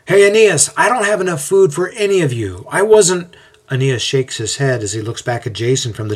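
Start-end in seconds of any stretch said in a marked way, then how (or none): 0.1-3.4 high, raised voice over a crowd; storybook yell, pitched well up. A narrator's reading. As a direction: yell out in flustered protest. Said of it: "Jason yells out".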